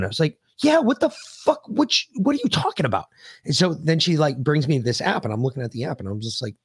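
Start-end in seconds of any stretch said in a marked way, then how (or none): 0.6-3.1 mock disbelief, pitched way up; about to lose it. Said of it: "I was about to lose my shit".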